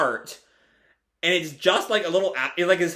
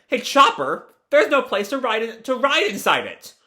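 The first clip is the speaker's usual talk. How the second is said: high, eager cartoon voice; excited calling out; 7 semitones higher than usual. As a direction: call a friend over to look. Luffy calls to Chopper, acted.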